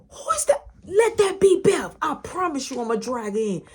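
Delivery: high, loud threatening holler; mock-furious mama on the warpath; angry mom. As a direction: imitate a mother yelling through the house. Mom yelling threats from upstairs.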